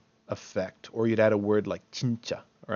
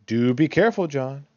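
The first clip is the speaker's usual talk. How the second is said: "Do" stressed, louder and raised; emphatic request; 4 semitones higher, 6 dB louder than usual. Shown as a demonstration. Teacher demonstrates a request to John, "Do" emphasized. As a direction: urge him, leaning on "Do". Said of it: "add emphasis to demands or requests".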